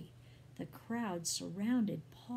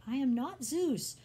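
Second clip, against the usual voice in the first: loud, higher-pitched protest; emphatic denial; 6 semitones higher, 6 dB louder than usual. A narrator's reading. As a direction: insist forcefully to a crowd. Barnabas protests to the worshipping crowd.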